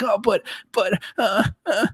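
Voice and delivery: high, whining stammer like a fake sob; mock whimper. Coach playing a whiny employee, high fake whimper. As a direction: whimper pathetically. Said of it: "and he keeps doing, like, this thing".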